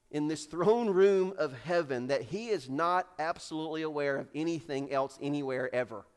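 ordinary delivery

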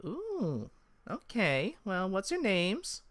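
high-pitched elf voice; high, curious elf woman; intrigued elf. Acted. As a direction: coo it high, then ask. Elf woman responds to visitors.

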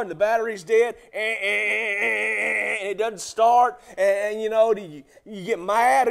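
loud, ringing sermon delivery; preaching at full force. Acted. Pastor preaching loudly to his congregation about saints. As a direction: preach it out to the whole church.

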